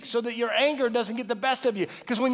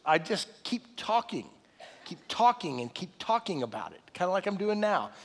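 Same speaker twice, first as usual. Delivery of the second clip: usual speaking voice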